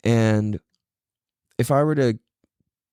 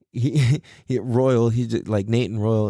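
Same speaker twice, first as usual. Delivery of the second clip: ordinary talk, nothing marked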